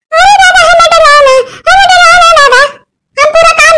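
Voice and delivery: high frightened begging with a nervous laugh; scared pleading. Acted. Old woman begging the spirit not to scare her, frightened plea. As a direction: plead for mercy, half laughing in fear.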